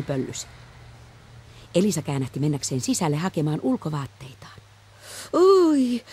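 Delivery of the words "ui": high loud yelp at the cold; startled yelp from the cold; cold shock yelp. Acted. Reaction to the cold.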